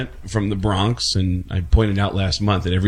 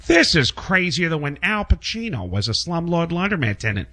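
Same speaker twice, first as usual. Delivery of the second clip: Peter Griffin; 7 semitones higher than usual. Retold or imitated. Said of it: "as Peter Griffin".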